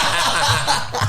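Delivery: a silent, breathy wheeze of laughter; breathless wheeze; wheezing laugh. Real, not acted. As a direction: laugh without voice.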